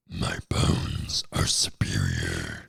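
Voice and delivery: slow rumbling low boast; huge, slow deep monster voice. Shown as a demonstration. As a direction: drop to the very bottom and drag it out. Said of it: "my voice isn't that deep. I'll try to".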